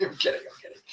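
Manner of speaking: laughing through it. Real, not acted. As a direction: giggle the take-back.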